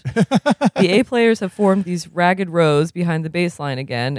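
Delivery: plain reading voice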